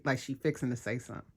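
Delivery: usual speaking voice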